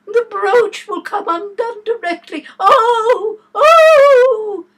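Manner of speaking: high, very loud wailing cries; screaming. Acted. Queen fretting over her brooch, screaming. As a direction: wail out each oh.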